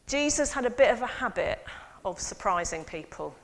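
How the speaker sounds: ordinary talk, nothing marked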